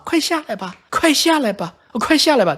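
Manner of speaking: mock-tender, high coaxing; doting mother act. Mother coaxing her son down from a plane, mock doting. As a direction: coax like a sugary, put-on loving mother. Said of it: "做那种慈母状啊".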